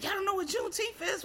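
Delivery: high incredulous teacher question; mock disbelief. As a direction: imitate a teacher amazed they don't know. Teacher's surprise acted out.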